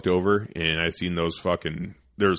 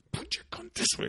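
whisper yelling; 8 dB quieter than usual. Retold or imitated. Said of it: "whisper, whisper yelling".

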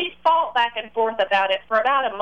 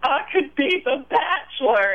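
higher, drawn-out tearful quote; half-acted tearful wail, pitched up; mock sobbing; 7 semitones higher than usual. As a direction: imitate him breaking into sobs, slowly. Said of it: "and just start sobbing".

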